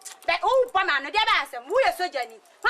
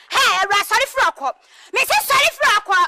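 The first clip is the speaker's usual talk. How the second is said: loud, raised order to get up; angry shout; 3 semitones higher, 6 dB louder than usual. Acted. Scolding someone to get up, angry shout. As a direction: bark it as a furious command.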